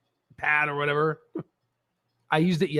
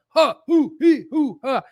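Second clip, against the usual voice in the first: mock whoops; 6 semitones higher, 3 dB louder, slower than usual. Mocking market swings.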